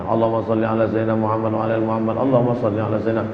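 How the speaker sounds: fast, low murmured blessing; rapid salawat. Shown as a demonstration. Preacher showing how people recite salawat, fast murmur. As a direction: rattle the salawat off quickly and low.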